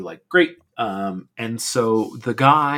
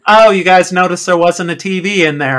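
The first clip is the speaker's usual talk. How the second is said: teasing neighbor; 7 semitones higher, 9 dB louder than usual. Retold or imitated. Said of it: "And she goes".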